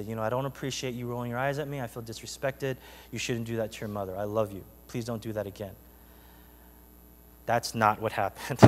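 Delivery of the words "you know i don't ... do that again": soft, low, gentle reproof; warm and loving. Acted. Imagined mom correcting her child, warm and loving. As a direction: speak softly and tenderly, as an idealized patient parent. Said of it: "in a warm and loving way".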